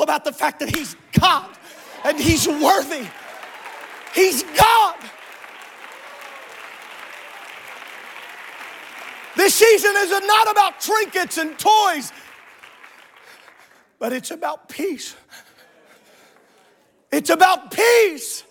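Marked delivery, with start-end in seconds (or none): 1.1-12.1 raised, punchy preacher's shout; full-force preaching. Real, not acted. 14.0-15.2 soft, restrained aside to the congregation; hushed preaching. Real, not acted. Preacher says it softly, then shouts. 17.1-18.4 louder, slow, emphatic proclamation; preacher's shout. Real, not acted.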